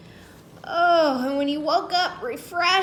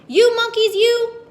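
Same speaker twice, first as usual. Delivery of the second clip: high, loud scolding of the monkeys; angry shout; 11 semitones higher, 9 dB louder than usual. Acted. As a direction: yell at the monkeys. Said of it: "He says".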